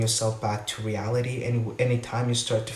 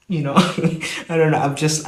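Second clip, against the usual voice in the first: real burst of laughter, louder; laughing through the words; 5 semitones higher, 6 dB louder, faster than usual. Real, not acted. Podcaster cracks up at her own rant, laughing. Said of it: "I'm laughing right now".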